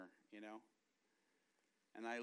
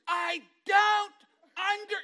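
huge high shout, word by word; slow hollering; 17 dB louder, slower than usual. Retold or imitated. Wife shouting at husband she thinks is deaf, slow hollering. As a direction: holler slowly as if to someone deaf. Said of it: "she starts shouting".

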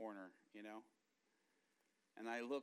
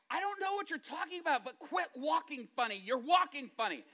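high, loud nagging; exasperated scolding; 11 semitones higher, 9 dB louder than usual. Retold or imitated. Wife telling husband to quit walking funny, exasperated scolding. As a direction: scold him in a raised, high voice.

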